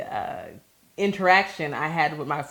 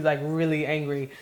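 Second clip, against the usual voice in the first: normal talking voice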